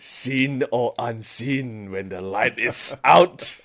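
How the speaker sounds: slow, mock-ominous tagline delivery; spooky sign-off. Co-host signing off the ghost show, slow mock-spooky. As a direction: draw out the horror tagline, a laugh slipping in.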